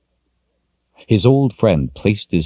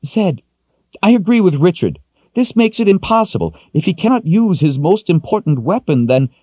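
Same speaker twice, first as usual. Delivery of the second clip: put-on high, louder frustrated young woman's voice; 14 semitones higher, 4 dB louder than usual. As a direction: push back in exasperation. Kalen sides with Richard.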